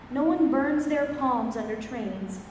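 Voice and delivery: normal talking voice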